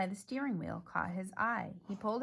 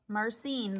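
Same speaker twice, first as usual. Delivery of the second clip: ordinary delivery, nothing marked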